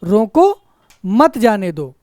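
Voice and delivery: "रोको" rising and cut off by a pause, then "मत जाने दो" falling; रोको, brief stop, मत जाने दो; pause after रोको. Shown as a demonstration. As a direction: break clearly after रोको, keep मत with जाने दो. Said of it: "फिर ठहराओ थोड़ा सा".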